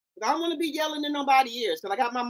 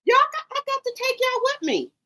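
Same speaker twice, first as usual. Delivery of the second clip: playful falsetto; 12 semitones higher than usual. Pretend. Teasing viewers about the party.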